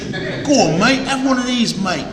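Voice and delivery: a light put-on Australian cockney offering a drink; Australian cockney mate-talk, teasing; mock Australian cockney. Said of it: "Some Australian cockney".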